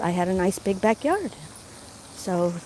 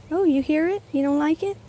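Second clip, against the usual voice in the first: pet talk; 6 semitones higher than usual. Real, not acted. Talking to her dog.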